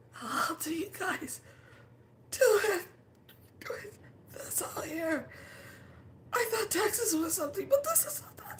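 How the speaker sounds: high, pleading, half-crying voice; high mock-whimpering complaint; whiny distress. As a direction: plead like an overwhelmed newcomer. Asking elders, overwhelmed.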